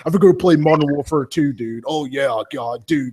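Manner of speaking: hyped stoner gamer bro, each "dude" dropping off. Acted. Guest mimicking Xbox fans at school, stoner dude voice. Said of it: "Yeah, the stoner motherfuckers".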